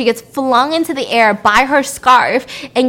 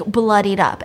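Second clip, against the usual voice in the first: normal talking voice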